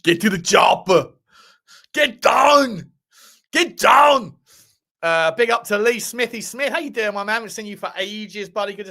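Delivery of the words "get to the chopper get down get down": loud action-hero shout, pitch jumping up; Arnie. Acted. Streamer joking for viewers with film catchphrases, shouted Arnie impression. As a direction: bellow it like a hammed-up action star. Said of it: "Arnie post-stroke".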